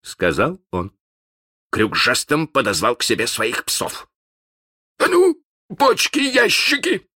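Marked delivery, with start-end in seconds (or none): 5.0-7.1 high, loud roaring captain; thundering. Acted. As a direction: boom it across the deck. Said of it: "загремел он".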